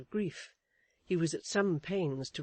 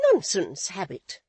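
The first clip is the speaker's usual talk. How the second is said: sharp retort; 3 semitones higher, 8 dB louder than usual. A narrator's reading. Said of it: "returned his wife".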